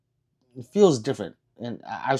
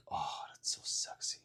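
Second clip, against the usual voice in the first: whisper; 17 dB quieter than usual. Retold or imitated. Retells his thought seeing swords.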